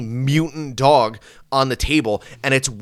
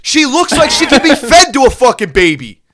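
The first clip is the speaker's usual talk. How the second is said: furious rant; 9 semitones higher, 6 dB louder than usual. Real, not acted. Ranting to his friend about the tiny dog, shouting. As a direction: scream it out in disbelief.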